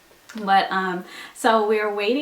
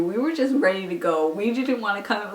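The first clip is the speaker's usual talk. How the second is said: ordinary talk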